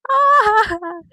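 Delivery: a sudden high shriek; a loud high startled squeal; high loud mock yelp. Imitating surprised yelp.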